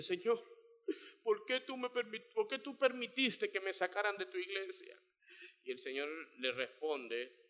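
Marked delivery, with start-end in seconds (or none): 0.0-5.0 mock weeping plea. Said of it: "Y ahí llorando".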